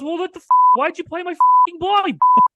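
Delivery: very high, mock-furious yell; hammed-up outrage climbing to a squeal; fired-up imitation. Retelling the friend confronting the woman, mock fury. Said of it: "he's all fired up".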